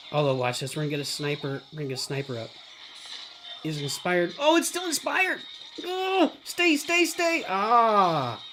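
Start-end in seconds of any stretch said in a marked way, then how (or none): 4.4-8.4 very high, urgent cries; frantic excitement. Real, not acted. Player begging a unit to stay, frantic. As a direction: plead with the unit in a squealing high pitch. Alarmed reaction to play in game.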